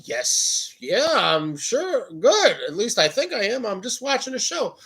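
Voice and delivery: high, hesitant retold answers; puzzled reassurance. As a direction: answer high, as if baffled by the question.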